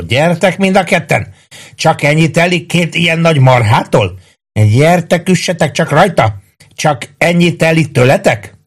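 high, jeering challenge; shouted taunts. A narrator's reading. Maggio taunts the two policemen, high acted shouting. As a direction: goad them in a high voice. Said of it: "kiáltotta Maggio".

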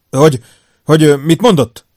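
stunned. A narrator's reading. Dunn hears of his wife on the phone, stunned. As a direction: stammer it out in stunned disbelief. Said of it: "Dunn döbbentnek hallatszott".

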